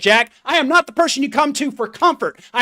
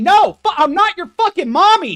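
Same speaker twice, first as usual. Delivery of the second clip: higher, louder furious snap; angry outburst; 5 semitones higher, 6 dB louder than usual. Real, not acted. Coach yelling at viewers who want comfort, angry and loud. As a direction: blast them, real anger.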